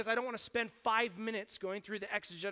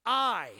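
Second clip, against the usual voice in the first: "I" set apart, a touch louder, then a pause; stress on I; 6 dB louder than usual. Shown as a demonstration. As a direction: hit "I" alone and hold a pause after it. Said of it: "meditate on every word individually".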